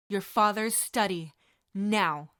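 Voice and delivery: loud, clipped order; icy command; cold command. Acted. Walburga ordering Sirius to the study, loud and cold. Mother orders son away.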